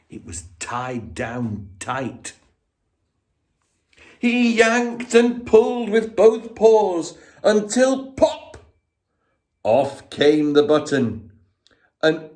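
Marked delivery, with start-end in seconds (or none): 4.2-8.6 building strain to pop. A narrator's reading.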